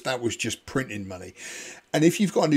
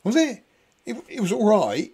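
unimpressed, sceptical; 8 semitones higher, 4 dB louder than usual. Retold or imitated. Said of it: "And I'm thinking".

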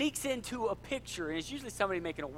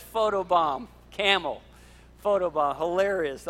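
usual speaking voice

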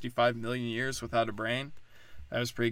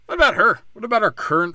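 loud, higher mock-indignant quote; mock outrage; 4 semitones higher, 7 dB louder than usual. Commentator parroting critics of George Washington, mock outrage. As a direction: hammer it out in put-on outrage. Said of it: "So before we say".

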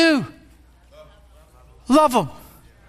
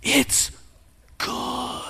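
whispered aside; 7 dB quieter than usual. Acted. Hushed aside about sex.

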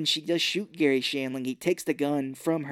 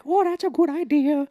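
high, loud goofy Adam Sandler voice; squeaky mock Sandler delivery; Adam Sandler impression; 14 semitones higher, 6 dB louder than usual. Podcast host mimics Adam Sandler's line for co-host, high goofy voice. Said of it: "Adam Sandler is like".

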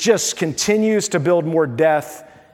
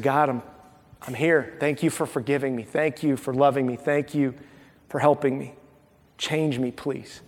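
hushed prayer; 5 semitones lower, 7 dB quieter than usual. Pretend. Acted humble prayer to God.

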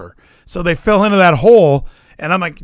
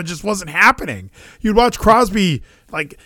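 ordinary talk

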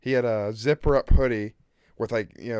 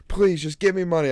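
pleading; 6 semitones higher, 4 dB louder than usual. Retold or imitated. Retold beggar on boardwalk pleading.

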